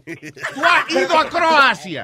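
slow, loud, high demanding yell; half-joking shout. Real, not acted. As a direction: bellow the question at him, laughing. Demanding an answer from a friend.

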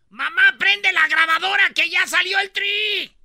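high, very loud yell to mom; mock frantic high-pitched fan yell; excited fan shout. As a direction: holler it across the house, hammed up. Acted fan calling out to mother.

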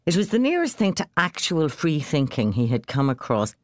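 ordinary delivery

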